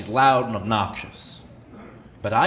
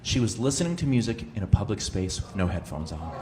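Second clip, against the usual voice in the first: normal talking voice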